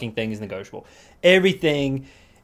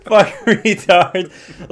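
slow loud proclamation; 7 semitones higher, 9 dB louder, much slower than usual. Pretend. Reading out the bracelet slogan to his friend, slow and loud. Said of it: "repeats the bracelet slogan".

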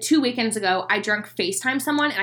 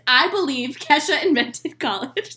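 slow, higher, amused mock declaration; mock thesis statement; 4 semitones higher than usual. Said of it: "such a thesis".

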